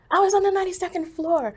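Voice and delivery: high, loud, startled reply; urgent exclamation. Retold or imitated. Telling a woman seeking her husband, urgent high exclamation.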